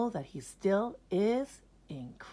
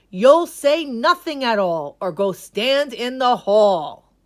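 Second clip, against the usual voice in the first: loud, firm and higher laying down the law; stern principal; 6 semitones higher, 9 dB louder than usual. Acted. Principal lays down a strict rule for Ned, loud. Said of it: "the principal said".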